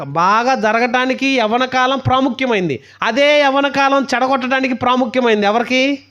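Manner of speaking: high, loud and slow, driving each word home; preaching at full force. Acted. Preacher warning the youth about the devil, forceful sermon. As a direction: thunder it at the congregation.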